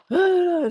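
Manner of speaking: long high sigh that falls away; heavy sigh. Retold or imitated. Sighing into the phone to her friend, weary and high.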